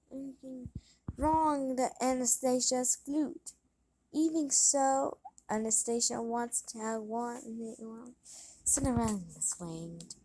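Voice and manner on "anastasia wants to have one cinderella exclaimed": usual speaking voice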